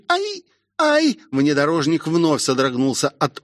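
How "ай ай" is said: two very high yelps; high girlish "ai" of alarm; startled yelp. Acted. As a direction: yelp twice in fright. Car is struck, Rosie yelps.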